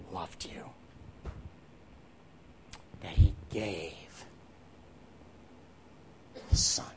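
soft and slow, fading to breath; retold director's words, whispered with emotion; hushed, broken whisper. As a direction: let each word nearly vanish, awestruck.

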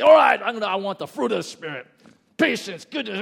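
loud, high, strained exertion; forced striving. Acted. Over-eager striver vowing to get the Spirit's fruit, strained shout. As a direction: belt it out as if straining hard.

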